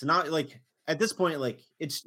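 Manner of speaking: ordinary delivery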